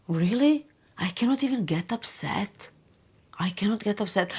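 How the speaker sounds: whispered, amused disbelief; inner whisper. Retold or imitated. Her inner self, whispering that she can't get upset. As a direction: breathe it like a private thought to yourself. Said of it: "deep inside I'm like".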